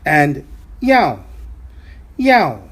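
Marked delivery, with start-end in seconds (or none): 2.2-2.6 yao said sharply falling, fourth tone, a little louder. Shown as a demonstration. Teacher models yao falling for the class. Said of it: "is a fourth tone character".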